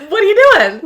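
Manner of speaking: high, loud incredulous outcry; mock outraged disbelief. Acted alarmed challenge in retelling.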